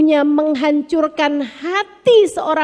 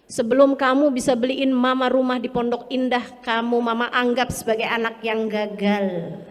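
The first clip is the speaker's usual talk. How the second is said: lightly voiced mother, low and quiet; cold ultimatum from a mother, softly imitated; low, fading imitation of a mother's threat; mock demanding mother; 4 semitones lower, 5 dB quieter than usual.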